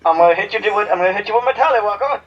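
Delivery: high, laughing silly voice; a hammed-up comic threat; goofy voice. Joking with friends, high laughing goofy threat.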